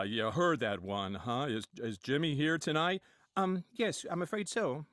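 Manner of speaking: ordinary talk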